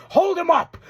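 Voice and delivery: high-pitched imitated yell; retold scream. Recapping Doyle screaming an order at Andrade, mock yell. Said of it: "screams to Andrade".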